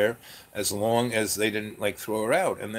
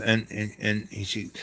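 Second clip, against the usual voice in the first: normal talking voice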